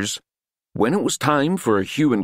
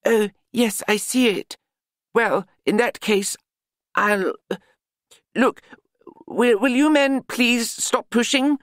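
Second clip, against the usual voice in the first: a high, pompous older gentleman, lightly voiced; peeved gentleman fussing over the mess; wizard character voice; 10 semitones higher than usual. A narrator's reading. Same wizard voice continues.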